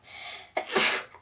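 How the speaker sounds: real sneeze into the elbow; muffled sneeze into a sleeve; sneezing. Real, not acted. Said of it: "You sneezed into your elbow just then".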